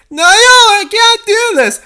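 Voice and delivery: high, loud panicked cry; terrified cry. Acted. Dangling princess cries out to her rescuer. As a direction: cry out that you can't do it.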